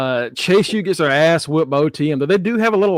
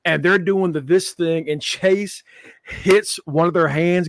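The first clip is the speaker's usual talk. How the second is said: ordinary delivery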